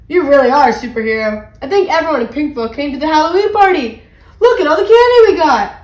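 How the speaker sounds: loud, building excitement; gush, rising to a high exclamation; thrilled child's outburst; excited little brother. A narrator's reading. Excited brother praises hero sister.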